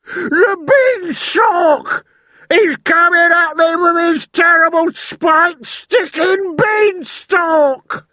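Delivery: very high, loud, slow wailing; terrified scream. A narrator's reading. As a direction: plead for mercy in screaming panic. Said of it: "screamed the flesh lump eater".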